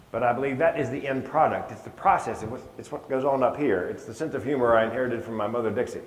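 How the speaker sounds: normal talking voice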